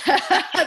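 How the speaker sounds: a burst of high, bright laughter; real laugh. Real, not acted. Host laughing at the group running out of time, a burst of real laughter. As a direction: laugh out loud at yourself.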